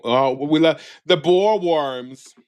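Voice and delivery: ordinary talk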